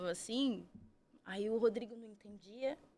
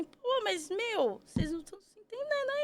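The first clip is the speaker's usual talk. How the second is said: high, incredulous complaint; mock outrage at the staff; protest, fed up; exasperated; 12 semitones higher than usual. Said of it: "Daí eu falei assim".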